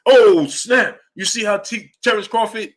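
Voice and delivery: high, loud mock-excited gasp of a fan; hyped fan. Fan hyped by a knockout, imitating excitement. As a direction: play the wowed fan after the fight. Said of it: "you're gonna watch".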